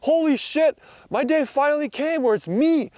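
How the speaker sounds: high, thrilled exclamation; stoked. Retold or imitated. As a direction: gush like your dream just came true. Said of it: "I'm like".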